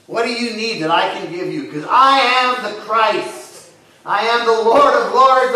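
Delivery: rising from loud to booming declaration; Jesus speaking. Acted. Jesus offering help to the blind man, booming. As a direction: build it up to a proclamation. Preacher voicing Jesus, building.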